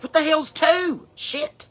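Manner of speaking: high, loud, drawn-out challenge; cheeky retort. Retold or imitated. Daughter talks back to dad's counting, cheeky and loud.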